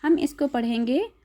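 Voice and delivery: usual speaking voice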